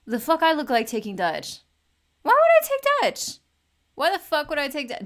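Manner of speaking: loud, incredulous rant, pitch shooting up; loud disgusted outburst, voice spiking high; outraged. Real, not acted. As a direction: spit out the disbelief. Outraged rant about taking Dutch.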